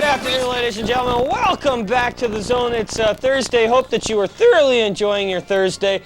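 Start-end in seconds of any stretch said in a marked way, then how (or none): none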